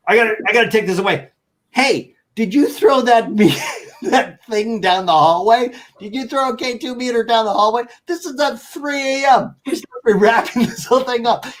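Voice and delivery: high, loud, fast accusing, laughing through it; mock accusation. Nick grabs the mic to accuse the investigator on air, mock accusation. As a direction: grill him half-laughing about the thrown meter. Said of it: "He's accusing that guy".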